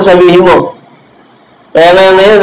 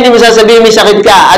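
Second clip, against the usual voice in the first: ordinary talk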